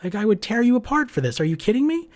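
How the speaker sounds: a retold indignant outburst; mock outrage. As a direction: act offended and disbelieving. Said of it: "And I'm like".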